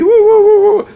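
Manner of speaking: high, loud "woo woo woo"; excited whooping. Acted. Acts his excitement about New York.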